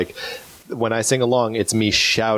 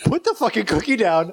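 laughing; 10 semitones higher than usual. Real, not acted. Laughing scolding friend.

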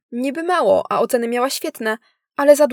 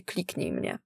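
kliknij mnie said lower and softer, stress on kliknij, falling; 7 semitones lower, 12 dB quieter, slower than usual. A narrator's reading. Narrator names what she searched, low and soft. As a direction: drop the voice and let kliknij mnie fall.

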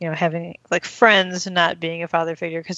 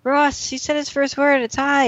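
high, thrilled retold exclamation; excited announcement; 8 semitones higher than usual. Rachel calling Ross about the baby's first word, excited. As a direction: call out the big news, much higher.

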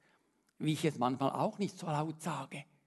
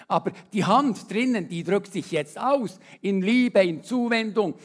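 building, louder sermon climax; fervent preaching; 6 semitones higher, 8 dB louder than usual. Acted. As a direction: drive the image home to the church. Preaching at full force. (steigernder, lauter Predigtton)

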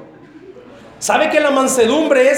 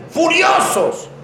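furious; 7 semitones higher, much slower than usual. Pretend. Acting out angry red face.

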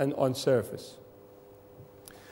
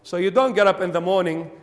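ordinary talk, nothing marked

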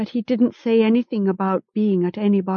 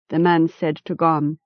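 usual speaking voice

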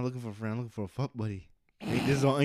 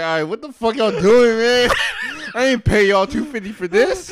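louder, higher mock outrage; mock angry manager; 8 semitones higher, 9 dB louder than usual. Imitating the manager who paid them, loud mock anger. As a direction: yell it like a fed-up manager. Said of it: "the manager be like".

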